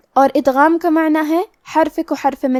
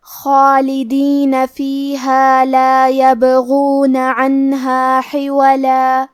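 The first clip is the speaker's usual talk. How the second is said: slow, melodic tajweed recitation; Quran recitation; slower than usual. Shown as a demonstration. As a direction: chant the verse slowly and evenly. Teacher recites Quran verse. (آہستہ، لحن کے ساتھ قرآنی تلاوت)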